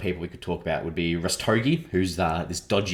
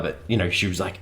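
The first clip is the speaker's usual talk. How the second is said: ordinary talk, nothing marked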